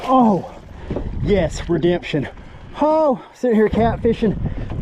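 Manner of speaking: overjoyed angler shouting at his fish; excited. Real, not acted. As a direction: let the relief burst out over the catch. Landing a big bass.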